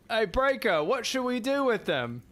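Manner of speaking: lightly voiced soldier hailing his mate; soldier calling a comrade.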